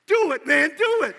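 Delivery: loud, high "do it, man"; loud urging. Retold or imitated. Doug urging Peter to wash his feet, loud. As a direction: urge him on, big and loud. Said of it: "just like that".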